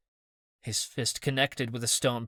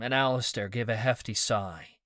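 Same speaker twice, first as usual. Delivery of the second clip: plain reading voice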